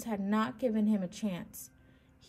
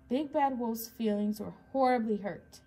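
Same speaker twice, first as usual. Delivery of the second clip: plain reading voice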